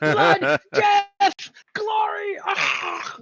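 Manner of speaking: screaming war cry, high and loud; berserker battle cry. Pretend. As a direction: roar it like a frenzied berserker.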